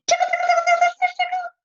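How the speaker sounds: very high squeaky tickle chant; high loud playful tickle voice; playful tickling. Retold or imitated. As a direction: tease with a high silly singsong. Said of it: "and I went".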